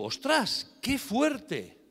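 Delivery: amazed. Retold or imitated. Retold own amazement.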